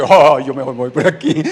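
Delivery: loud, laughing mock retreat; comic escape. Child slipping away from mother's "pero", laughing mock alarm. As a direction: blurt it loudly through a laugh.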